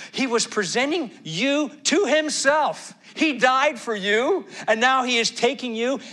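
high, loud, driving sermon delivery; ringing pulpit proclamation; impassioned preaching. Real, not acted. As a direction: preach it with full force, pitch soaring. Preaching at full force.

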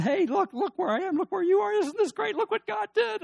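thrilled, showing off his blessings; very excited Joseph. Retold or imitated. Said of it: "Joseph was so excited".

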